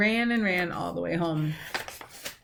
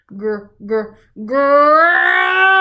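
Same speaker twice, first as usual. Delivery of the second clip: growl building; 6 semitones higher, 11 dB louder, much slower than usual. A narrator's reading. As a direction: growl ending high and loud. Growl quoted.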